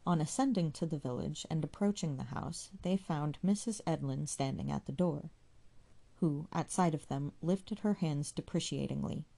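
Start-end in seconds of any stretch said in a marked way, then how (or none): none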